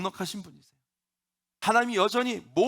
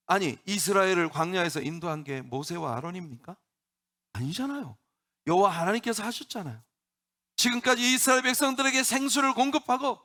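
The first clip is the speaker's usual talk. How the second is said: usual speaking voice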